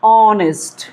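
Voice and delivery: ऑनेस्ट with a long, held "aw" at the start; teacher's stretched model of ऑनेस्ट, h left silent; silent-h pronunciation demo. Shown as a demonstration. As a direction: say ऑनेस्ट drawn out as "awww-nest", no h. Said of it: "इसको क्या पढ़ते हैं".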